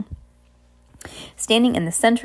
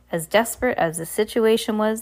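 ordinary delivery, nothing marked

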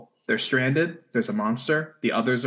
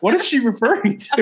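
high, louder, laughing disbelief; puzzled mock question; 11 semitones higher, 5 dB louder than usual. Recalling reading a friend's notes, laughing confused question. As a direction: toss it up high through a laugh. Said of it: "I was like".

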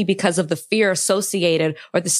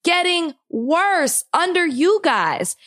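angry outburst; 7 semitones higher, slower than usual. Real, not acted. Venting to her cohost about the country, shouting angrily. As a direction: drag it out in real anger. Heated rant about politics.

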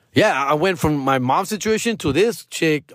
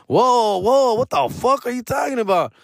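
mock shock; 11 semitones higher than usual. His shocked reply to chores.

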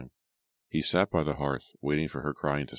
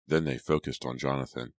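plain reading voice

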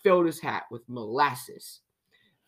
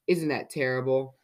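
plain reading voice

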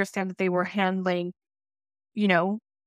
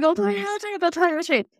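much higher, pleading through tears; tearful panic; 9 semitones higher than usual. Retold or imitated. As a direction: give in, scared and crying. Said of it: "I'm, like, crying".

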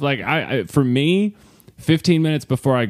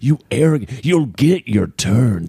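lightly voiced documentary old man; grumpy old man. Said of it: "can you use an old man voice like you're in a Ken Burns documentary".

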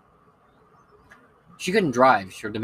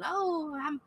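much higher, slow Southern granny sympathy; sweet Southern old lady; slower than usual. Retold or imitated. Lily apologizing to her sick roommates, high Southern coo. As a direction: coo an apology like a kindly grandma.

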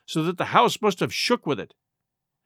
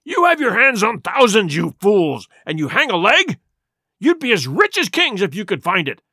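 raised, forceful scolding of a crew; berating; 3 semitones higher, 4 dB louder than usual. A narrator's reading. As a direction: harangue the hesitant men angrily. Blind man berates his hesitant men.

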